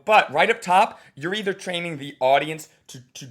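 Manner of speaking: normal talking voice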